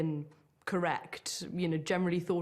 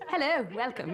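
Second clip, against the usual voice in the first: put-on old-fashioned clipped RP; 6 semitones higher than usual. Shown as a demonstration. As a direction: greet like an early BBC broadcaster in crisp old RP. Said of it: "that kind of very clipped, old-fashioned sort of received pronunciation accent".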